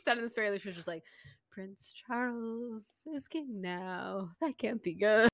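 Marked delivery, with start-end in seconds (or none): 1.5-5.3 quiet, slow sing-song deadpan delivery; sing-songy matter-of-fact. Retold or imitated. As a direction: lilt it softly, totally matter-of-fact. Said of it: "like, sing-songy, like, quiet, matter-of-fact voice".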